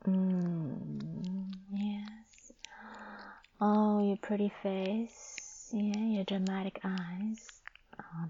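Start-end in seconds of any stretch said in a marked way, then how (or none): none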